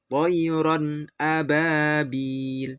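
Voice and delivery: toyron ababil slowly, ron and a kept clearly apart; clear izhar. Shown as a demonstration. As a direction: recite toyron ababil with a clean nun before a. (toyron ababil dibaca jelas, izhar)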